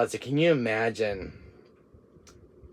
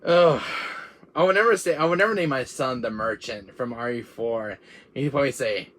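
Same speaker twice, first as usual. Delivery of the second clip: ordinary delivery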